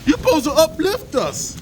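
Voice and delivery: high, whiny mock voice; mocking whiny critic. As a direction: mimic the complaining woman. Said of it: "mocking critic's voice".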